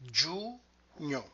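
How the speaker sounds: "Giugno" split into long giu, then gno; two separate clusters. Shown as a demonstration. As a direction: sound out "Giugno" one cluster at a time. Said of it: "contains two of those clusters".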